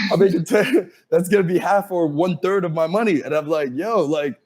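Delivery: mock panicked disbelief; incredulous protest. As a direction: balk at the rent like a startled kid. Balking at five grand rent.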